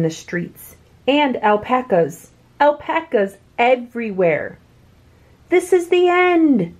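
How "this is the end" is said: panicked shout. Acted. Everyone in the streets crying out in panic. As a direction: wail it like the world is ending. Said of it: "shouted everyone".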